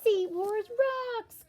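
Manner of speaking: loud, high, slow joyful shout; excited cry. Acted. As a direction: cheer out loud while playing. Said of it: "cried Clark".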